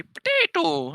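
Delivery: drawn-out silly nonsense call; weird noise. Retold or imitated. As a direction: repeat it as a random odd noise. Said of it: "make really weird noises for no reason".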